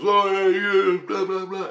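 high, sing-song mocking of a heckler; a smart-ass brushing him off; mocking chirp. Neighbor heckling him in a high mocking sing-song. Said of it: "He goes".

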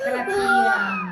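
very high, slow, loud wail; drawn-out cry. Acted. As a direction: stretch it out like a wail. Word for garlic, drawn out.

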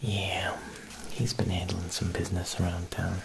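normal talking voice

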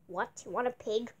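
usual speaking voice

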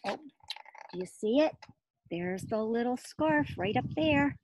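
ordinary delivery

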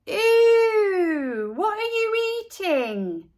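put-on high, drawn-out grossed-out kid; high squeal of disgust; disgusted boy. A narrator's reading. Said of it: "Buster asked".

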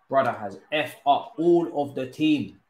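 ordinary delivery

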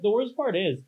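high, strained voice; thrown-out voice. Real, not acted. Complaining about four bad reviews, voice thrown out. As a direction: voice giving out mid-rant. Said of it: "I threw my voice out".

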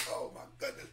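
breathy whispered oh my goodness; awed whisper; whispered awe. Real, not acted. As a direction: whisper it, overcome. Overcome with a chill.

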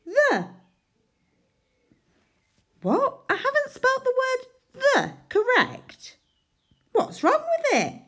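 very high hammed-up dismay; mock shock. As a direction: act horrified for the class. Mock shock at spelling error.

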